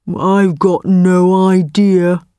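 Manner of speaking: slow, heavy beast voice; slow buffalo voice. Acted. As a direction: drag it out like a lazy buffalo. Buffalo answers the tiger.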